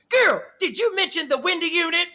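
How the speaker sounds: high, loud mock Bunny voice; imitating Bunny. Bunny chasing her down to ask about the unit, loud high imitation.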